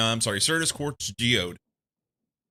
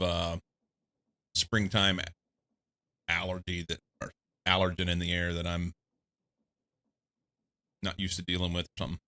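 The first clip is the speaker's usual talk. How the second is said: ordinary talk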